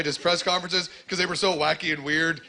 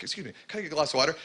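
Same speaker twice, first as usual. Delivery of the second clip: fast, softer tourist request; rushed polite request; 5 dB quieter, much faster than usual. Retold or imitated. Tourist asking a Paris waiter for water, fast and soft. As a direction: rattle off the question politely. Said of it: "I was like".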